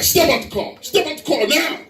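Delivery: high-pitched police command; mock officer getting tough; stern trooper order. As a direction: order the driver out, rising on the repeat.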